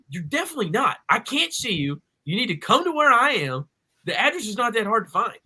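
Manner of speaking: high-pitched, swooping retold exasperation; exasperated insistence. Customer telling lost driver to come over, exasperated. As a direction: argue it in a high, exaggerated singsong. Acted phone dispute with delivery driver.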